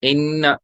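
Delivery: इन्ना with the nn held in the nose; nasal ghunna. Shown as a demonstration. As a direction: hum the doubled n of इन्ना. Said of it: "उनमें गुन्ना होगा". (इन्ना में गुन्ना के साथ)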